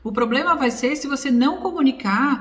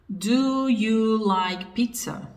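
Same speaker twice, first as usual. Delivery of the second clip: very slow, drawn-out English question; slow example sentence; much slower than usual. Shown as a demonstration. Teacher modelling an English question for students, very slowly. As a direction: stretch each word out for learners. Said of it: "a gente inverte a ordem".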